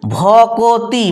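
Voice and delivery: ভকতি drawn out as bho-ko-ti; very slow demo. Shown as a demonstration. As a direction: stretch ভকতি into three even syllables. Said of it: "আর হলোটা কি".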